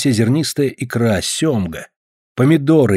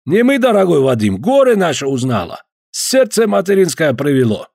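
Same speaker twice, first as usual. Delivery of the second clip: put-on Caucasian accent; 6 semitones higher than usual. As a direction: stretch each word with a heavy Caucasian accent. Georgian visitor voiced.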